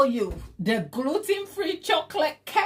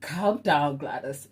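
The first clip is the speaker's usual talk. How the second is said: self-soothing inner voice; 9 semitones lower than usual. Acted. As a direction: talk yourself down gently and low. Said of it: "my inner self-soothing and my inner talk".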